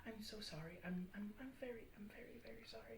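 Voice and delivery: soft, fading stammered apology; apologize, voice sinking away; mild fretful apology. Acted. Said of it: "you hear her go".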